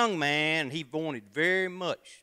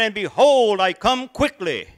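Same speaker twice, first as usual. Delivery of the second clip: much higher and much louder preaching shout; booming proclamation; 8 semitones higher, 12 dB louder than usual. Acted. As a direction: belt the verse to the back pew. Second try, even bigger.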